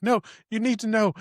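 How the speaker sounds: urgent pleading. Retold or imitated. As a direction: plead with Mom, pitch pushed up high. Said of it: "he's like".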